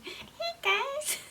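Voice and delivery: squeaky high chipmunk voice. Shown as a demonstration. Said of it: "like chipmunk".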